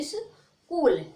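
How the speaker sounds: स्कूल said slowly with a hard k and no ch; school slowly. Shown as a demonstration. Teacher gives the final slow model of school. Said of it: "तो इसको पढ़ोगे".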